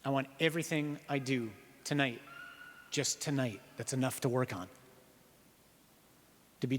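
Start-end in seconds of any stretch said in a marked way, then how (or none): none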